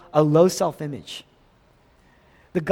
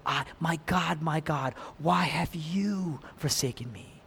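hushed plea; 9 dB quieter than usual. Retold or imitated. Jesus's cry, quoted again.

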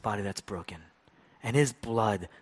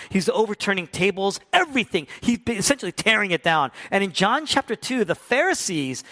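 rising, louder preaching; building sermon; 8 semitones higher, 7 dB louder than usual. Real, not acted. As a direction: build the intensity as you preach. Preaching builds to full force.

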